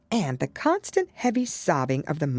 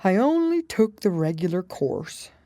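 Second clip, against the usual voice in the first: sighing turtle; 5 semitones higher than usual. A narrator's reading. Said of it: "with a sigh".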